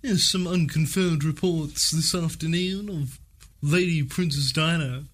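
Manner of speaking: news reporter voice. Retold or imitated. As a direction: read it like a breaking bulletin. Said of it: "they were like".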